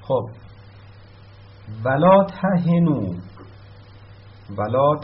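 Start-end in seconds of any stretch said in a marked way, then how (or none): 1.8-3.1 ولا تهنو recited slowly and loudly, higher; recited verse. Shown as a demonstration. Teacher reciting the verse slowly and loudly to the class. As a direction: intone ولا تهنو like Quran recitation, drawn out.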